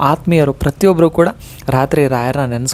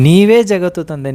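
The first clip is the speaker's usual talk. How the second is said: raised fervent plea to the father; fervent cry; 4 semitones higher than usual. Shown as a demonstration. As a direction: cry out ನೀವೇ ಜಗತ್ತು ತಂದೆ with ardent pleading. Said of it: "ನೋವನ್ನೇ ನಾನು ಹೇಳ್ತೇನೆ".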